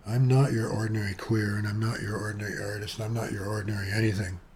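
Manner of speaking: ordinary talk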